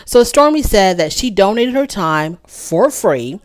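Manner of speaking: ordinary talk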